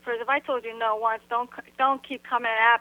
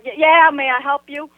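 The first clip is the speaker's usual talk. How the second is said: louder, mildly irritated offer; kinda mad; 5 dB louder than usual. Retold or imitated. Annoyed resident answers girl at car window, louder, mildly mad. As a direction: retell it with a touch of annoyance. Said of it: "now I'm kinda mad".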